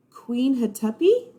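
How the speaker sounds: questioning call. Acted. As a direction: call for someone who may not be there. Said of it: "called Annie".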